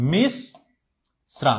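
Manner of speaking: stretched-out মিশ্রা, each sound drawn out slowly; drawn-out মিশ্রা for the class; slow demo. Shown as a demonstration. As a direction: spell out মিশ্রা slowly like a teacher. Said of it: "এই যে একটা হসন্ত এখানে".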